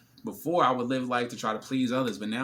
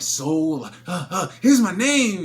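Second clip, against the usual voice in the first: much higher, louder nervous guy offering his name; eager guys; 7 semitones higher, 11 dB louder, much slower than usual. Retold or imitated. Every guy at her table, nervous and eager. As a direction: stammer it out like a hopeful suitor. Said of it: "everybody's like".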